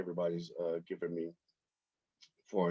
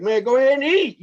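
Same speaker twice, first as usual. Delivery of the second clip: voicing the bird; 9 dB louder than usual. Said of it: "it's like".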